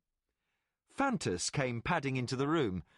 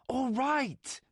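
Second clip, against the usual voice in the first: aggravated; 11 semitones higher than usual. Acted. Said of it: "he said in aggravated tones".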